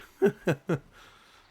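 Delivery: loud, high burst of laughter; amused laugh. Real, not acted. Player laughing at the game suspect's denial, amused laugh.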